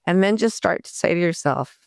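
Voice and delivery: usual speaking voice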